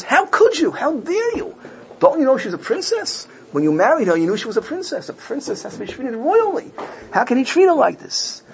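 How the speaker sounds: high-pitched indignant reproach; outraged, incredulous father's scolding without shouting; upset king. Retold or imitated. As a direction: protest in disbelief, pitch leaping up. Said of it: "the king gets very upset".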